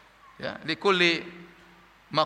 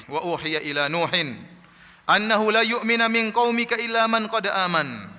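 slow, drawn-out Arabic recitation; Quran recitation; 4 semitones higher, slower than usual. Acted. Preacher quotes God's words to Nuh, measured recitation. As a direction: recite the verse slowly, voice lifting on the second phrase.

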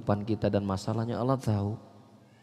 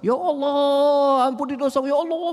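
mock loud lament, much higher and louder; mock wailing prayer; 14 semitones higher, 7 dB louder than usual. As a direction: ham up a shrieking prayer to God. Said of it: "sampai kepada menjerit".

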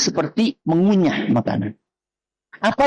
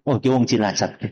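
ordinary delivery

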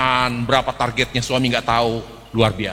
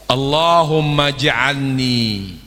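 "Allahumma ja'alni" stretched out very slowly; very slow call for the audience to repeat; very slow recitation; much slower than usual. Shown as a demonstration. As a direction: prompt the audience to recite along. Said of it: "Atau mau bahas Indonesianya aja?".

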